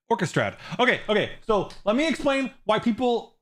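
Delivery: usual speaking voice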